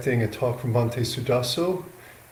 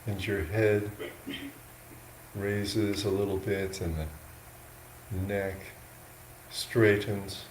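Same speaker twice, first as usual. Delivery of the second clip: slow, unhurried guidance with long pauses between phrases; guided meditation; 2 semitones lower, 8 dB quieter than usual. Real, not acted. Monk guiding yogis into an upright sitting posture, slow meditation voice. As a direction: talk them gently into posture, a little softly, leaving space.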